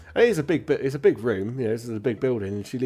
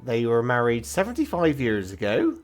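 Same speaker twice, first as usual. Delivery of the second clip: informative records clerk; 5 semitones higher, 6 dB louder, slower than usual. Acted.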